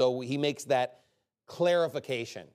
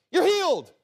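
Jesus heals; 7 dB louder than usual. Acted. Jesus voiced in a story.

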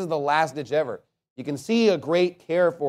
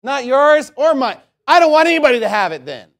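high, loud spiteful outburst; acted rage; 9 semitones higher, 8 dB louder than usual. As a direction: voice the vindictive woman, pitched up and loud. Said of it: "What a psychopathic rage".

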